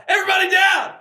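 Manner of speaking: high, loud hammed-up "get down" yell; mock robber shout. Pretend robber with a baby yelling at the room, mock shout. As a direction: bark orders like a bank robber, for laughs.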